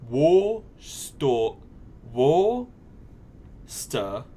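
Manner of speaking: "Worcester" drawn out slowly, syllable by syllable; sounded out. Shown as a demonstration. Streamer sounding out Worcester for chat. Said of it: "sound it out".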